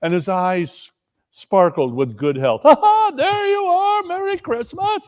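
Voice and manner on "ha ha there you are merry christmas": high, laughing holiday greeting; cheery nephew. Acted. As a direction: hail Uncle with delight.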